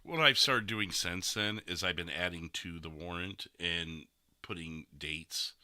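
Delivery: ordinary talk, nothing marked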